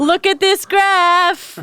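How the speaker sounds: slow, high mimicking whine; mocking high voice. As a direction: echo her in a high drawl. Mocking the cohost.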